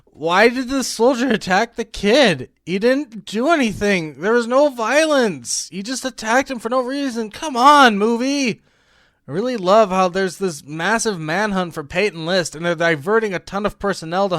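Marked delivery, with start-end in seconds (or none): 0.0-8.6 high-pitched, incredulous whine; pleading, fed-up complaint; exasperated. Real, not acted. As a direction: protest indignantly at the nonsense. Exasperated complaint about the plot.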